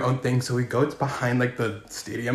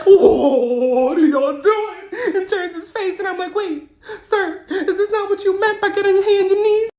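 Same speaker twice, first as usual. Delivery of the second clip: high, laughing, half-crying voice; laughing retelling; 4 dB louder, slower than usual. Retold or imitated. Caught by the sergeant while mopping, high and laughing. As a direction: laugh through the whole line.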